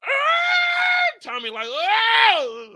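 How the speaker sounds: high loud shriek; panicked scream. Retold or imitated. Tommy screaming at the train, high and loud. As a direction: let out a high scream of fright.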